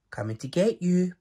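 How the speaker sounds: a hint of a big bear's hide-and-seek call; playful boom. A narrator's reading. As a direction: announce it like a game of hide and seek. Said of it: "Boris boomed".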